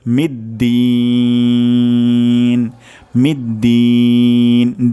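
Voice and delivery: মিদ্দিন with দিন held three alif long; long din. Shown as a demonstration. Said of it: "এখানে আমরা তিন আলিফ পরিমাণ টান দিব". (মিদ্দিন: দিন তিন আলিফ টেনে)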